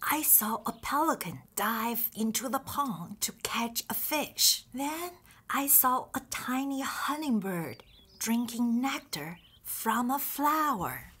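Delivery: ordinary talk, nothing marked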